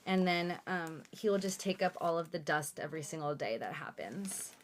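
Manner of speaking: ordinary talk, nothing marked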